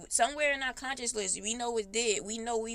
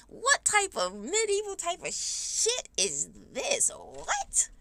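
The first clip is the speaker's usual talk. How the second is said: higher, slow, disbelieving question that climbs to a squeak; incredulous; 9 semitones higher, slower than usual. Real, not acted. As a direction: drag it out in scornful disbelief. Mocking the church's demand.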